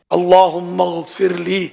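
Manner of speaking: slow, measured Arabic prayer, each word drawn out; slow dua recitation. Shown as a demonstration. Cleric demonstrating a short dua to listeners, slow recitation. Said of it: "کہہ دیا".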